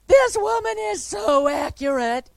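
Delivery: high, loud, amazed exclamation; excited announcement. Retold or imitated. Woman with the microphone telling the church, amazed and loud. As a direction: announce it to the whole room, thrilled.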